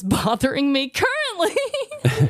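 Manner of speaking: giggling through the words; sheepish laugh while talking. Real, not acted. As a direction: laugh it out, a little embarrassed. Laughing about her topic.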